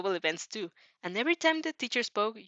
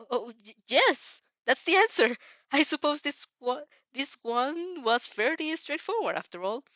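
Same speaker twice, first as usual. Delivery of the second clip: flustered teacher; 5 semitones higher, 4 dB louder than usual. Acted. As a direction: stumble into the answer as the startled teacher. Teacher caught off guard.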